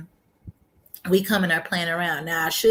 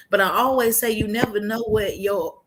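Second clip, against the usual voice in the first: normal talking voice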